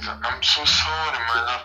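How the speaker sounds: ordinary talk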